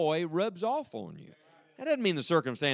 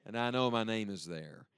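ordinary talk, nothing marked

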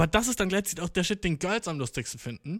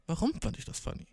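whispered musing; 2 semitones higher, 7 dB quieter than usual. Retold or imitated. Inner question, quietly wondering.

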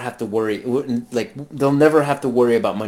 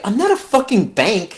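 higher-pitched mock indignation, retold; incredulous quoted refusal; indignant refusal; 6 semitones higher than usual. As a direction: act out an affronted lender. Voicing Wade refusing a loan.

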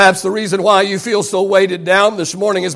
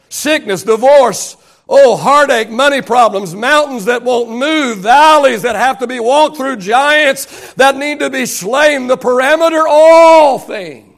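slow, building list climbing to a loud, high finish; full-force preaching; 4 semitones higher, 4 dB louder, slower than usual. Real, not acted. Preacher listing what all things consist of, building full-force preaching. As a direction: stretch each trouble, then belt the last phrase.

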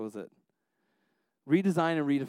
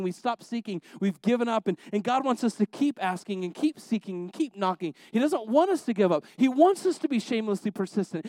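high-pitched, insistent sermon delivery; fervent preaching; 6 semitones higher, 4 dB louder than usual. Acted. Preacher urging the congregation to keep asking, fervent. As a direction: drive each repeated point home, pitched up.

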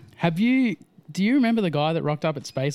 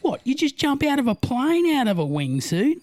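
much higher, louder dopey questioner voice; mock clueless stranger; 12 semitones higher, 3 dB louder than usual. Mocking a stranger who doubts wingsuiting in a high, dopey voice. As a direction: play a baffled know-nothing.

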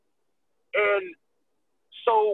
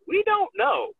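mock clueless; 12 semitones higher, slower than usual. Friends' clueless answer.

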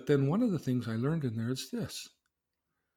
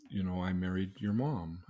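normal talking voice

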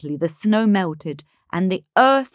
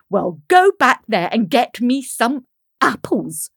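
higher, louder bossy demand; 4 semitones higher, 5 dB louder than usual. Acted. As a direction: order her about imperiously. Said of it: "demanded Helena".

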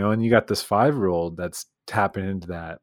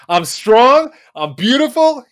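"I'm strong, I'm beautiful" called out much higher and louder; loud affirmation; 10 dB louder, slower than usual. Retold or imitated. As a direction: shout the affirmation like the kid told to say it louder. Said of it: "No, Johnny, say it louder".

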